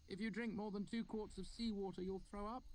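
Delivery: high unsure voice ending on a question; student testing his fact on the teacher; hesitant student. A narrator's reading. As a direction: offer it as a nervous guess. Fenton's hesitant guess.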